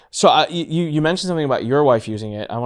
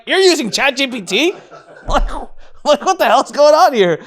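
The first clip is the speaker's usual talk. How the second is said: high, loud incredulous outburst; replayed stunned outburst; retold astonishment; 12 semitones higher, 5 dB louder than usual. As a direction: react in disbelief, voice shooting up. Acts out own astonished reaction.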